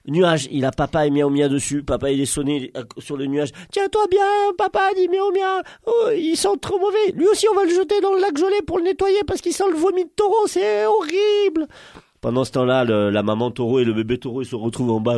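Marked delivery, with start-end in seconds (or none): 3.7-11.7 very high squeaky kitten voice; chaton écœuré. Acted. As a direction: fuss in disgust, stretching the final word. Chaton écœuré porte papa. (petite voix suraiguë de chaton dégoûté)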